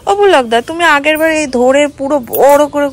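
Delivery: loud, high call to Pulak across the yard. Real, not acted. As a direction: shout his name to reach him.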